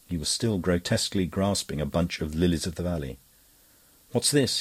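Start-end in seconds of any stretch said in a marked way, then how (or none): 4.1-4.6 cried out. A narrator's reading. Cutler thrust into the doorway, crying out high. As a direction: snap the question out in alarm. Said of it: "he cried".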